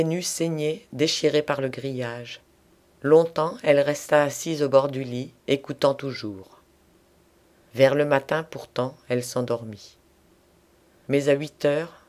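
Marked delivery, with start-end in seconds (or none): none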